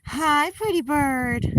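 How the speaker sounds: slow, high, drawn-out pet greeting; cooing hello. Real, not acted. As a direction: stretch it out sweetly for the bird.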